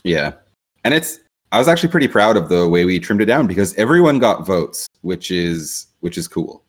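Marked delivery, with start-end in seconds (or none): none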